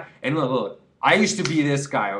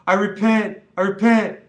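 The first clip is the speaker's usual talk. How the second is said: gushing, high and loud; gushing fake repentance, hammed-up; mock converted weed fan; 9 semitones higher, 9 dB louder than usual. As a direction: ham up a sudden convert. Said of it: "you'd be like".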